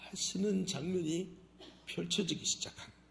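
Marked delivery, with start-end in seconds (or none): none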